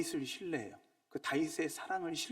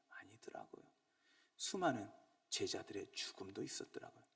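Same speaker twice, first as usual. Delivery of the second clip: hushed confiding reply; softly answering his own question; whisper; 5 semitones lower, 8 dB quieter than usual. Real, not acted. Hushed aside.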